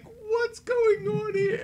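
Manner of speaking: high cry, slow and stretched out; mock shocked falsetto cry. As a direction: squeal it out in a high voice, slowly. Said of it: "and it was like".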